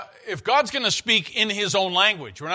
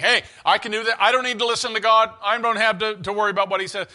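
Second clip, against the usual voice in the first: louder, higher, cocky boast; mock arrogance; 5 semitones higher, 6 dB louder, faster than usual. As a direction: play the proud, self-sure leader. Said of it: "He's not saying".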